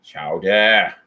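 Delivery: low-pitched mock Boston "Chowder"; terrible Boston accent. Singer retrying chowder for his partner, mock Boston accent. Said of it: "a terrible Boston accent".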